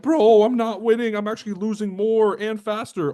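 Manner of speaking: high, loud whiny complaint; complaining loser trader. Retold or imitated. Mocking traders who bought the wrong course, high whine. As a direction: whine about losing money. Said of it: "Now they're like".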